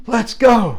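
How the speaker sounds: high, loud urging cry; rally a friend to bolt; urgent call. Retold or imitated. Boy to friend as they start running, urgent call.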